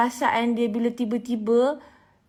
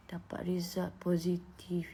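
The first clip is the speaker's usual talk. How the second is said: slow, breathless whisper; 2 semitones lower, 8 dB quieter, slower than usual. Retold or imitated. Whispering the positive result to her followers. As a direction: hush it out like shocking news.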